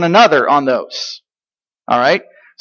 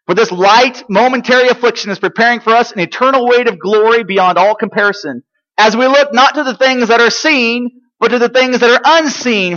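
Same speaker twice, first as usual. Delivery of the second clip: raised, declarative Bible reading; preaching scripture aloud; 4 semitones higher, 4 dB louder than usual. Real, not acted. Preacher reading Paul's letter to the congregation, raised and emphatic. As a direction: proclaim the passage to the congregation.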